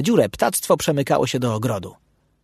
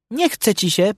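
teasing, higher-pitched jeer; needling question, pitched up; mocking; 8 semitones higher than usual. A narrator's reading. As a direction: ask it as a taunt. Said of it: "pytał drwiąco Bursztyn".

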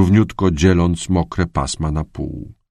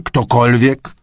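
snapped, raised growl of a question; 6 semitones higher, 4 dB louder, slower than usual. A narrator's reading. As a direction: bark it at the whole team. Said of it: "warknął".

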